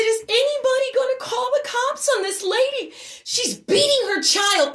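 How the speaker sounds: high, urgent call to bystanders; outraged appeal. Retold or imitated. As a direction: cry out for someone to step in. Appealing to onlookers for help.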